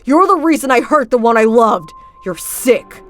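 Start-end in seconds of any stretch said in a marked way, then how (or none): 0.0-1.9 louder, raised furious rant; angry outburst. Acted. Luca turns on Felix, furious and loud. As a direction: hurl the hate at him.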